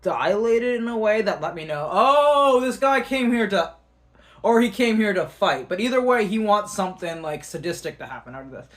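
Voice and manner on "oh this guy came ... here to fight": loud, high re-enacted dawning realization; high-pitched 'oh' easing as it sinks in; sudden realization. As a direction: let it dawn on you, loudly. Acting out alarmed sudden realization.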